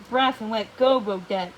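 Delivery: usual speaking voice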